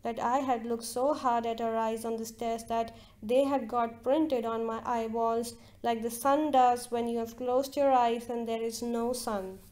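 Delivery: ordinary delivery, nothing marked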